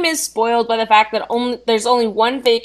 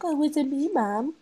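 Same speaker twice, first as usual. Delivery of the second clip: a light, higher, softer mock-innocent dog voice; innocent brown noser; 5 semitones higher, 7 dB quieter than usual. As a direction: play the teacher's pet, hint of coy.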